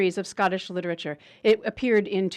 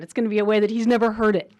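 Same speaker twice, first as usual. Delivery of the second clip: normal talking voice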